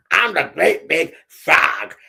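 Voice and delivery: slow, boastful frog boss; bullying big frog. Acted. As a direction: drag out each word as a cocky bully. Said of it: "He was a bit of a bully".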